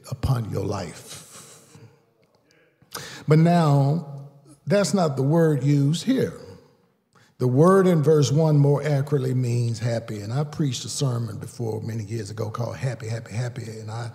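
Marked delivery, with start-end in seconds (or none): 3.3-10.2 higher and louder preaching cadence; preaching build. Real, not acted. Preacher building intensity for the congregation. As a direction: lean in and preach it.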